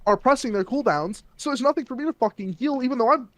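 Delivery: glitchy high-pitched mic; helium voice. Real, not acted. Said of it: "Did he get really high pitched there".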